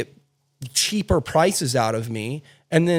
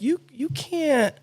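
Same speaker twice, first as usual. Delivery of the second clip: mock-outraged what do you mean, pitched way up; incredulous retold outburst; 10 semitones higher than usual. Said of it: "And I'm like".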